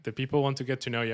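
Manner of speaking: ordinary talk, nothing marked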